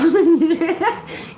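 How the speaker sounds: real laugh rising high; high burst of laughter. Real, not acted.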